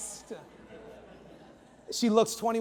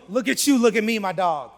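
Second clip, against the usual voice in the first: loud imitated taunt; confrontational; 8 dB louder than usual. Angry train rider taunting the storyteller, confrontational. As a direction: size him up with loud swagger. Stranger sizes up the teller.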